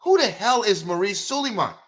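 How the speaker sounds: ordinary delivery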